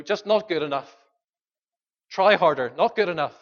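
mock a demanding taskmaster; harsh scolding. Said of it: "He's not saying that with a tone of".

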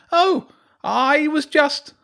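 put-on very high, slow, loud boy's voice; Hugo backtracking. Hugo backing down when James snaps at him. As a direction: trail off, caught out. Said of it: "Hugo backtracked".

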